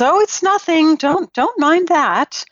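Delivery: high, sing-out dismissal; queen pretending nothing is wrong; airy brush-off. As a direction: wave the question away in a high voice. Queen brushes off the daughter's question.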